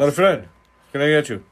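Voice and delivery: loud, high counter-man voice; boss impression. Imitating the boss greeting a customer, high loud impression. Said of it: "pretty good impression of the boss".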